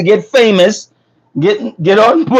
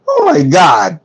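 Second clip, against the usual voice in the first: surprised shout. Real, not acted. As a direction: blurt it out in shock. Reacting to comments, shouting.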